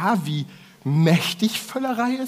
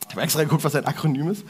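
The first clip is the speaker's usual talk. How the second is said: ordinary delivery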